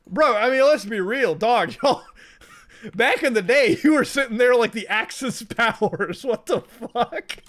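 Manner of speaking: loud, high disbelief; incredulous shout. Real, not acted. Disbelieving outburst at the teacher's story, loud and high. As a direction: call out the obvious, laughing at it.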